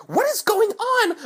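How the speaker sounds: high, loud, alarmed mom imitation; scared mom. Ty's mom startled by the kids, scared shriek. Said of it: "I scared Ty's mom".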